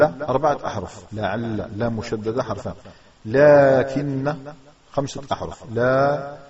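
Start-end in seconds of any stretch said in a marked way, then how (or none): 3.2-4.3 "لكن" drawn out very slowly and loud as laa-kinna; unwritten alif pronounced. Shown as a demonstration. Teacher counts the letters for students, "لكن" slowly drawn out. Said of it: "الألف لا نكتبها ولكن ننطقها". 5.7-6.4 "لا" held as a long laa; unwritten alif pronounced. Shown as a demonstration. Teacher shows the hidden alif, "لا" with long vowel. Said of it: "الألف لا نكتبها ولكن ننطقها".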